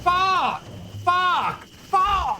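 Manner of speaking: loud, high yelped curse; frustrated shouts. Real, not acted. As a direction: curse loudly at a botched attempt. Failed jump in the game.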